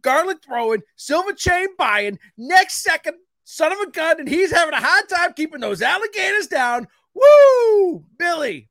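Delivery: builds to whooping high announcer hype; hype intro. Real, not acted. Host hyping up his cohost, escalating announcer whoops. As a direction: pump up the crowd as you introduce him.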